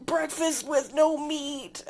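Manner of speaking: high, slow meme shout; meme catchphrase voice. Retold or imitated. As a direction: drag it out high like the Yankee meme. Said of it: "my head has been saying".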